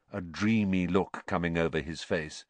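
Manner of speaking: usual speaking voice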